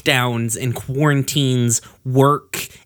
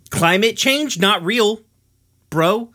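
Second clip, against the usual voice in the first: higher mocking bro voice, skeptical dude; mock podcast bro; 7 semitones higher than usual. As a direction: parody a smug bro just asking questions. Mocking Joe Rogan's podcast take.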